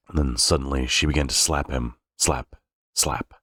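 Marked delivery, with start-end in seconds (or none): none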